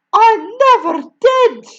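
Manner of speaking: very high, loud pale protest; exclaimed. A narrator's reading. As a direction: cry out in innocence. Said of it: "the boy exclaimed".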